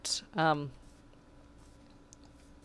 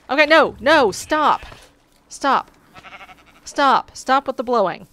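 loud, higher pleading stop; exasperated yelling; 5 semitones higher, 8 dB louder than usual. Real, not acted. Player being pushed by the wind mob, exasperated shouts. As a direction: beg it to quit, fed up. Blown around again, protesting.

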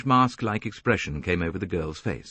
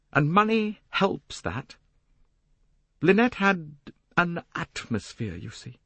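put-on higher young woman's voice; insistent defence of her lover; Jacqueline, insistent; 7 semitones higher than usual. Young woman explains glamour to the detective, insistent higher voice.

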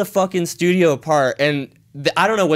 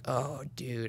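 under breath; 17 dB quieter than usual. Retold or imitated. Realising he is followed.

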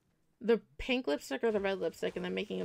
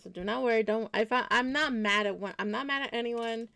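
normal talking voice

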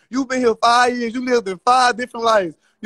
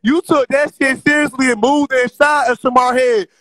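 heated loud taunting; 2 semitones higher, 5 dB louder than usual. Real, not acted. Host roasting a rival caller on live, loud and heated. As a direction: lay into them loudly, hammering each phrase.

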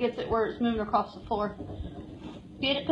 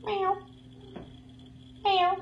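cat meow; 7 semitones higher, 7 dB quieter than usual. Retold or imitated. Imitating a cat.

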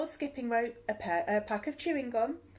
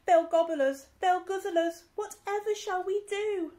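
high, louder frightened wail; panicked; 9 semitones higher, 5 dB louder than usual. Acted. Crew panicking about sharks, high frightened wail. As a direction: voice it like a terrified sailor.

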